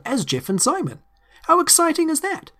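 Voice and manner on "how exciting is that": excited. Real, not acted. Host gushes about teaser.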